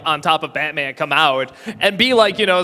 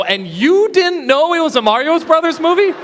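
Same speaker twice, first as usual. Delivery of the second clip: astonished, high-pitched challenge; incredulous question; 9 semitones higher, 3 dB louder than usual. Real, not acted. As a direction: voice climbing high in disbelief. Disbelieving question about obvious title.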